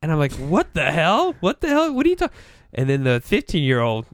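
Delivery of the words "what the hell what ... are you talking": high-pitched mock-bewildered outburst; retold alarm. Plumber retelling his shock at the veteran cussing, alarmed. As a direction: act out being stunned, voice leaping up.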